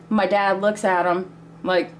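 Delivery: ordinary delivery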